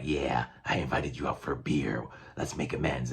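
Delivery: lightly voiced gruff Wolverine offering a truce; low mock-friendly invitation. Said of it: "And Wolverine's like".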